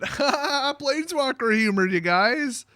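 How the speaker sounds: high, drawn-out laugh into the words. Real, not acted. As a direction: laugh through your own joke. Laughs at own joke.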